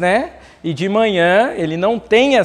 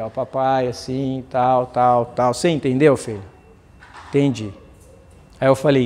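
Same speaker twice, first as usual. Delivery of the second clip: pai carinhoso; 6 semitones lower than usual. Retold or imitated. Pai e filho fazem as pazes.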